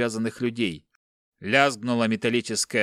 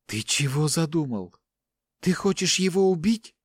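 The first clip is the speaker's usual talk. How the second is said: alarmed mother; 6 semitones higher than usual. A narrator's reading. Mother fears for her son.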